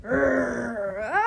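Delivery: short scared cry; mock scream. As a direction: yelp like you are spooked. Narrator pretends fright.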